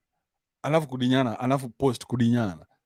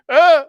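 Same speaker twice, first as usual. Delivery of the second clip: a hammed-up high, loud sob; fake-wail the word; husband begging forgiveness with a hammed-up cry; mock sob; 13 semitones higher, 8 dB louder than usual. Mock sobbing plea to wife.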